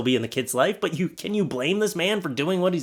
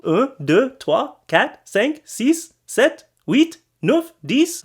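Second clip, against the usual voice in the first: high, loud French counting, each number swinging up; toddler-level French counting; 10 semitones higher, 5 dB louder, much slower than usual. Shown as a demonstration. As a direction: count in French like a proud beginner. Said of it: "toddler skills in counting in French".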